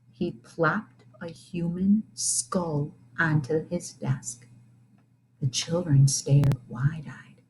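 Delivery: slow, soft creepy whisper; hushed spooky. A narrator's reading. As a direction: hush it like a ghost story. Spooky skull reveal.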